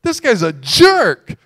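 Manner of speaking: loud, high exasperated outburst, drawn out; mad. Retold or imitated. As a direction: re-enact getting mad, voice jumping up loud. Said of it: "and I'm getting mad".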